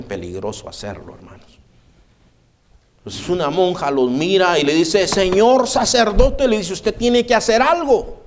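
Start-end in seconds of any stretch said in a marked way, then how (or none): 5.1-8.2 a nun begging the priest to act; alarmed nun. Retold or imitated. Nun alarmed to the priest about protestants.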